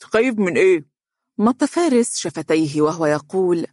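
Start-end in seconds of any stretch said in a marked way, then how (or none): 0.0-0.8 alarmed question through a mouthful; choked-up, rising question with food in the mouth; gulping chocolate. A narrator's reading. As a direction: struggle to swallow, then ask. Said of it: "وهو يبتلع قطع الشوكولاتة بصعوبة".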